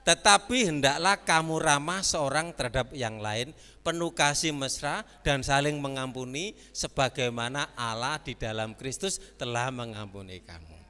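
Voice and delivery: loud, emphatic reading of the verse; loud preaching read; scripture reading. Acted. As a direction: proclaim the verse to the church. Reads scripture aloud forcefully.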